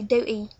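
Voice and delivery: "duty" said "du'y"; dropped T. Shown as a demonstration. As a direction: leave the T out of "duty". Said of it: "don't pronounce T as in duty".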